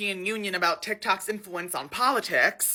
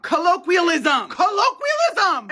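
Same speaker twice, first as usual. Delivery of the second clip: high exasperated cry; frustrated wail; 8 semitones higher, 6 dB louder than usual. Real, not acted. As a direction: yell it at the filter. Filter keeps rejecting word.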